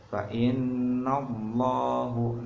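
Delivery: Fa innallahu sung slowly with a heavy, thick lam; thick lam jalalah. Shown as a demonstration. Teacher shows students the thick reading, slow chant. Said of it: "Cara bacanya seperti ini".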